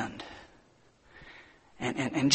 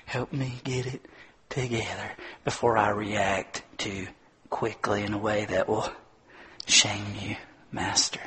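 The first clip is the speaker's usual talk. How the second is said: slow, much softer whispered prayer; hushed, slow plea for calm; quiet prayer; 4 semitones lower, 8 dB quieter, slower than usual. Shown as a demonstration. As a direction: pray it down under your breath. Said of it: "Just slow down and say".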